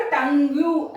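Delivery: टंग यू with a wrong extra u after the g; wrong way, spelled out. Shown as a demonstration. Teacher mocking a student's wrong reading, slowly. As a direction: drag out टंग यू slowly as the mistake. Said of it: "ऐसा नहीं pronounce किया जाता है".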